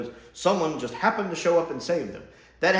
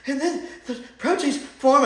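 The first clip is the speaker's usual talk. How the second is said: higher, parodying the Doctor's breathless explanation; mock excited lecture; 11 semitones higher, 4 dB louder than usual. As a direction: gush over proteins like an overeager lecturer.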